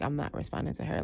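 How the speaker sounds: low quick refusal under breath; quiet refusal. Retold or imitated. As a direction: keep it low and fast, not engaging. Refusing to engage.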